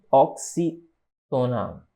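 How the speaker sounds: "Oxítona" very slow, split into pieces; "Oxítona" in slow, low dictation; syllable by syllable. Shown as a demonstration. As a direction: stretch out "Oxítona" with a gap, low. Teacher breaks up the term.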